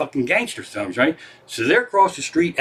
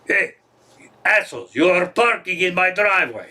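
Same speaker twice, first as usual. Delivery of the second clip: higher-pitched, put-on angry Russian driver; big Russian guy; 5 semitones higher than usual. Big Russian guy tells hitmen they're blocking his driveway, indignant. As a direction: play an indignant Russian guy confronting parkers. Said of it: "he's a big fucking Russian guy".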